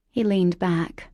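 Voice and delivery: plain reading voice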